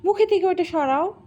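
something in his mouth; talking round an object; mouth full. Real, not acted. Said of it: "কথা তো সুন্দর শোনাচ্ছে না".

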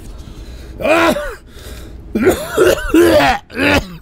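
high, retching heave; gagging cough. Real, not acted. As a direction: cough and gag as if about to vomit. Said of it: "I almost wanna throw up".

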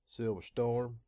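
usual speaking voice

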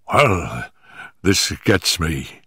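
low, slow character voice; baffled inspector. A narrator's reading. Inspector reacts to the strange creature, baffled low voice. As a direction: drop low and slow, stumped.

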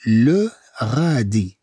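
"le غادي" drawn out slowly; slow pronunciation model for learners; slow syllables. Shown as a demonstration. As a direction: hold each syllable of "le غادي".

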